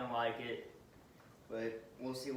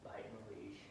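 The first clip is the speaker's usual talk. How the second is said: soft whispered remark; hushed aside to camera; 4 semitones lower, 10 dB quieter than usual. Real, not acted. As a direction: murmur it so the dog isn't startled. Quiet aside while dog bites.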